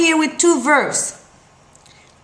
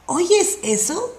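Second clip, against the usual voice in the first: expressive question; 6 semitones higher than usual. Shown as a demonstration. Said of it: "you have to do the expression".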